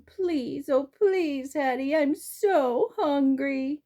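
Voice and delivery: high, slow whimpering beg; whimpering plea. A narrator's reading. Hungry dog begging Hattie, whimpering plea. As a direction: whine it like a pitiful hungry pup. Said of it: "I whimper and moan".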